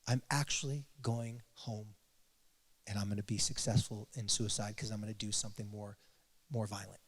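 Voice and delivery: quiet and low, a dark grim confession; low, quiet dread. Retold or imitated. Girl tells her therapist she will die, low and quiet. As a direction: let it sink low and soft, a hint of despair.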